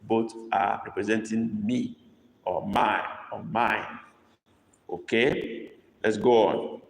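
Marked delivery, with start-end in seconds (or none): none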